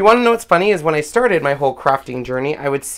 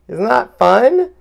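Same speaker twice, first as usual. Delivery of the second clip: high, drawn-out delighted question; excited showing-off; 7 semitones higher, slower than usual. Real, not acted. Crafter showing viewers the dazzling foil, excited. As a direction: coo it high and slowly.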